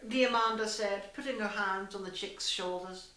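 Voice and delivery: ordinary delivery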